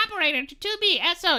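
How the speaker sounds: put-on high, fast radio operator girl; young energetic operator. Streamer imitating a game operator for chat, high energetic voice. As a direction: pitch way up and rattle it off perkily. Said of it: "Like, wha-, one of the operators".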